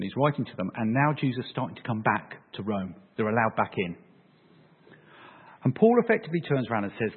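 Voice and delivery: ordinary talk, nothing marked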